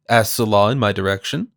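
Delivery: ordinary delivery, nothing marked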